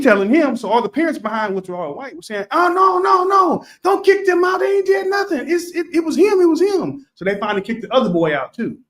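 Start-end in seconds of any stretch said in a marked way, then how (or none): 2.5-7.1 pleading shout. Retold or imitated. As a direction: shout for the kids to stay.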